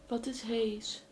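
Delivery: loud excited question. Real, not acted. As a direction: call out, thrilled and puzzled. Reacting to ghost app word.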